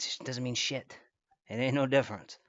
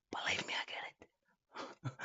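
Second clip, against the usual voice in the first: mock whisper; 10 dB quieter, slower than usual. Mock sympathy to chat.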